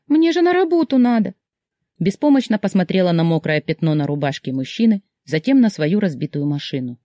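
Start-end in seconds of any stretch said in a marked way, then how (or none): 0.1-1.3 very high flustered cry; rushed alarm at being late; panicked. A narrator's reading. Heroine panics about being late.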